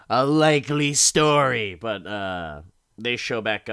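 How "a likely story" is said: theatrical sarcasm; mock scornful disbelief. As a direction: scoff loudly, acting unconvinced.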